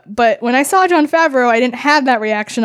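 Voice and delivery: usual speaking voice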